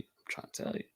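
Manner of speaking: repeats the line in a whisper. Real, not acted. Pressing the point on viewers, whispered.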